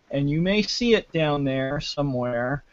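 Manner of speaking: normal talking voice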